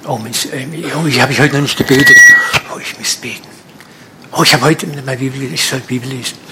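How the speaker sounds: fretful, hurried mock worrying; half-whispered mock panic about prayer; anxious inner voice. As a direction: act out a nervous believer's guilty thoughts. Preacher acts anxious believer's thoughts.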